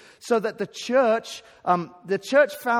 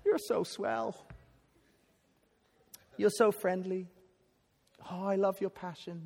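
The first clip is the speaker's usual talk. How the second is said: put-on American accent; 6 dB quieter than usual. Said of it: "Notice how I did that in American accent".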